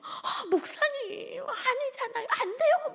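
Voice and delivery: very high, drawn-out squeal of protest; shocked young woman. Retold or imitated. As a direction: stretch it out, high and alarmed. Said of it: "막". (아주 높고 길게 끄는 놀란 외침)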